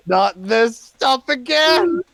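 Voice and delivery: high, loud, drawn-out protest; exasperated shout. Acted. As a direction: wail it in comic exasperation. Acted protest at another teleport.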